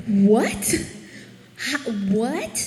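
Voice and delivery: stunned questioning, rising at the end; incredulous. Retold or imitated. Retelling Habakkuk's stunned answer to God, incredulous. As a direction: play a prophet taken aback.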